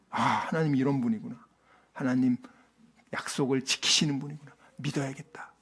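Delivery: soft, low realization fading to a whisper; hushed awe. Retold or imitated. Sarah quietly realizing God keeps his promise, hushed to a whisper. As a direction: murmur the dawning faith to yourself.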